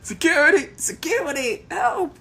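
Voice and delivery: high, loud panicked cry for security; frightened artist screaming for help; panicked shouting. Acted. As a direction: yell for the guards like you're terrified. Artist calls for guards.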